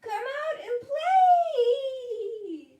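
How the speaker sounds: very high, loud mouse shout; shrieky long mouse call. Acted. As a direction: holler to a friend in a tiny high voice, drawing out the end. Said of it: "said Mouse".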